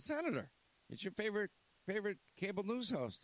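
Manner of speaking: high, playful mock-formal hail; teasing greeting. Host sneaking up behind the senator, teasing him with a high mock-grand greeting. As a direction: sneak up and needle him cheerfully.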